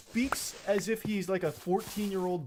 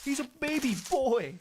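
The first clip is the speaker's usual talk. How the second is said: mocking baby talk; 8 semitones higher than usual. Mocking the boyfriend as childish.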